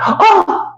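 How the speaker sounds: sudden realization, gasping "Oh". Real, not acted. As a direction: gasp as if it just clicked.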